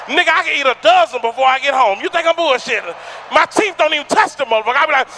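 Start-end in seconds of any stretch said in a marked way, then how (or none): none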